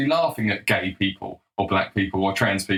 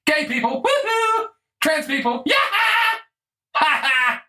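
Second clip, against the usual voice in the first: mocking crowd cheer. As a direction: whoop it up like a jeering crowd. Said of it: "we're all just going".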